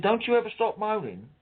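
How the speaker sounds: a light hammed-up nurse telling him off; mock snippy nurse. Retold nurse snapping at caller.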